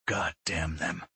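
ghostly hushed whisper. A narrator's reading. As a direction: breathe it out barely voiced. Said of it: "The words were a whisper".

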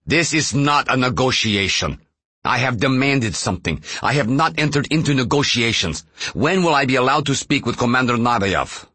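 acted shout, high and insistent; shouting into headset. A narrator's reading. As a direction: shout it into the headset, narrator's restrained version. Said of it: "Safronov shouted into his headset".

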